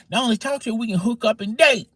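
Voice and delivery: high, eager voice for the woman; imitating Sarah. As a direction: play her bold and keen. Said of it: "she said".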